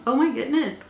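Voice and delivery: ordinary delivery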